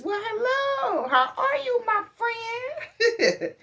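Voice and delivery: very high, slow, drawn-out greeting breaking into a laugh; bubbly store clerk. Retold or imitated. Imitating the Food Lion guy's high sing-song greeting, laughing. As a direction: sing the greeting, then crack up. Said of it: "he says".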